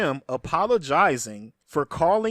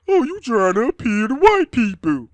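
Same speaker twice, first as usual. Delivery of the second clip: high, loud mock accusation; mocking commenter; 7 semitones higher, 7 dB louder than usual. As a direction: parody the accusing fans, pitched up. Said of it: "a lot of y'all feel like".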